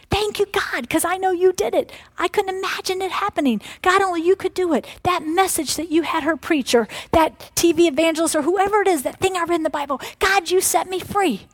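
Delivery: high, loud grateful praise to God; joyful praise. Acted. The set-free believer thanks God, high and joyful.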